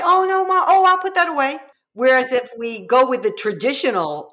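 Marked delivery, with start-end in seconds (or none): 0.0-1.6 put-on higher, eager child's reply; a kid happy to help; cooperative kid. Child answering mom's vented feeling, eager and apologetic. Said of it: "And they'll say".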